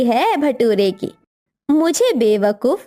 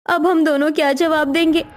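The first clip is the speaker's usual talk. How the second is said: normal talking voice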